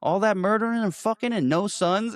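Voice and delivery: high scornful disbelief; hammed-up mob boss mockery; mocking son line. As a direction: sneer it out at the betrayer. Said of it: "he's like".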